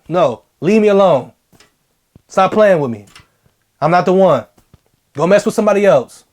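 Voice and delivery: steady, no-nonsense leave me alone; mean every word; firm and serious. Shown as a demonstration. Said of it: "Just like that".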